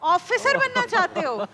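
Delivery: normal talking voice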